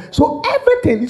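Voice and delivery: ordinary talk, nothing marked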